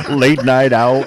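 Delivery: slow, loud, laughing. Real, not acted. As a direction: stretch it out through laughter. Joking among friends.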